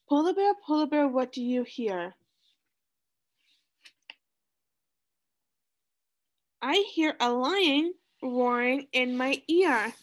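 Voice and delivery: sing-song storybook reading; reading to children. Acted. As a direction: read it like a picture book for kids. Reading picture book to class.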